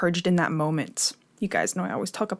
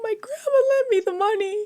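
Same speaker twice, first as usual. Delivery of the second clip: much higher, fast, frantic pleading; panicked, near tears; 14 semitones higher, faster than usual. Retold or imitated. She retells to her boyfriend on the phone, frantic and high. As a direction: rush it out high like you're about to cry. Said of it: "I started to panic".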